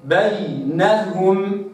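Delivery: بينهم drawn out slowly, ya held clearly; slow recitation. Shown as a demonstration. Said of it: "هكذا". (بينهم ببطء مع إظهار الياء)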